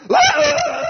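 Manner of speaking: very high, loud shriek; imitated demon scream. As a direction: scream like someone being murdered in a field. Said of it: "it went".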